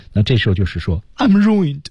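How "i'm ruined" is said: higher, drawn-out cry of ruin; acted despair. Teacher walking through the character's gestures, acted despair. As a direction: act out despair, pitch raised. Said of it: "明显是一种desperate的感觉".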